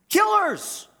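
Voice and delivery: high-pitched exclamation; sudden high cry; exclaimed. Acted. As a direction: fling the word out in disbelief. Preacher exclaims about soldiers.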